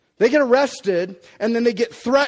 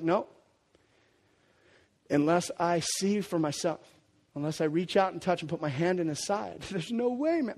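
a lightly voiced bullheaded Thomas; stubborn refusal; 5 semitones lower, 9 dB quieter than usual.

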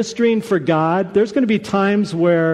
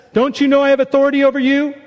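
higher, rushed retort from authority; quick, high-pitched pulling of rank; indignant challenge; 6 semitones higher, much faster than usual. Acted. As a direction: fire off a challenge in one quick run. Acted challenge from Pilate.

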